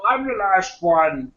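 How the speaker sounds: slow light Connery dragon echo; a hint of a Connery dragon line; Sean Connery dragon. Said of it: "Sean Connery is the voice of the dragon".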